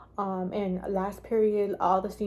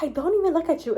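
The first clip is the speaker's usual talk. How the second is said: mock indignant denial; 7 semitones higher, 4 dB louder, faster than usual. Acted retort of the other girl.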